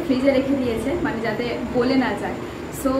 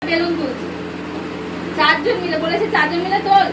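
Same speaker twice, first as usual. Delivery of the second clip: higher, loud pretend telling-off; mock scolding; 4 semitones higher, 4 dB louder than usual. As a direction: pretend to blame them, pitched up. Fake scolding during the prank. (ভান করে বকুনি, উঁচু গলায়)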